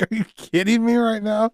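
high, incredulous squeak; mock disbelief. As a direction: pitch it up in disbelief. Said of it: "I was also like".

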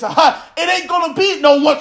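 ordinary talk, nothing marked

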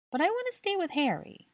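put-on high, whiny little boy; pouting child. A narrator's reading. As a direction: pout it like a small child. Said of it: "he pouted".

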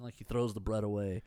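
usual speaking voice

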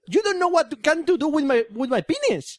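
high, silly mock foreign voices; high goofy voice playing at English. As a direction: ham up a clueless English speaker.

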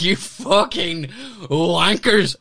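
drawn-out high furious insult at the bouncer; angry yell. Retold or imitated. Comic telling the bouncer off, drawn-out outraged insult. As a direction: spit it out, outraged. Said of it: "And I went off on them".

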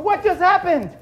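confused bystander. Acted. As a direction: ask it bewildered. Bystanders react.

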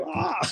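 high, small mock shriek; tiny high-pitched yelp; little boy squeal. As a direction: imitate a little boy's squeal, high and soft.

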